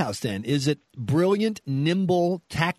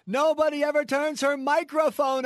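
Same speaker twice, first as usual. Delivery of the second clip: high, raised mock rally voice; 11 semitones higher than usual. Host joking that her mic is never on, high mock shout. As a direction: keep the hammed-up speechifying going.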